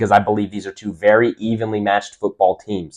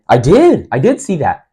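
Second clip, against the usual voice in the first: excited confirmation; 5 semitones higher, 5 dB louder than usual. Retold or imitated. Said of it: "you're gonna say".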